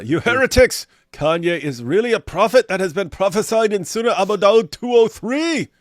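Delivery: high, loud ranting preacher parody; mock zealot rant. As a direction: rant at the heretics, hammed up. Mock-reads a zealot's comment.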